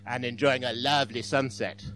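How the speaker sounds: hammed-up romantic Frenchman; mock French accent. Joke's Frenchman defining happiness, hammed-up French accent. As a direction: play a stage Frenchman relishing each phrase. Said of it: "And the Frenchman says".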